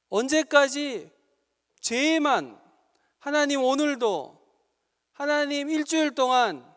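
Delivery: loud, rising sermon exhortation; fervent preaching. Acted. Pastor urging the young adults, in loud fervent preaching. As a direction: press the call home with full force.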